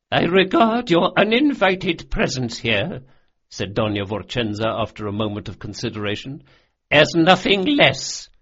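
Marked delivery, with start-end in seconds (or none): 0.1-3.0 high, raised voice of an affronted lady; haughty noblewoman. A narrator's reading. Old noblewoman replying to a threat of insult, haughtily. 6.9-8.3 high, loud, slow disdain; haughty noblewoman. A narrator's reading. Old noblewoman finishing her rebuff to the intruder, haughtily.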